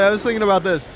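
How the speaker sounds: ordinary delivery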